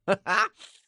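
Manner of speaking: loud high cackle; sudden loud laugh. Real, not acted. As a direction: let out a big real laugh. Laughing after teasing the viewer.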